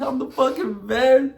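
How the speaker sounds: high, wailing affirmations; hammed-up sobbing boast; mock crying. Shown as a demonstration. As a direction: cry it out in an exaggerated wail. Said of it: "that sad and that crying emotion".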